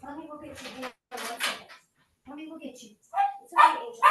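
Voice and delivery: soft high cooing to a dog; soothing a pet. Real, not acted. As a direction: gently coo to calm him. Mother comforting her stuck dog.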